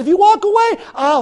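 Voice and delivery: high-pitched desperate threat; acted desperation. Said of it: "desperate words I didn't mean".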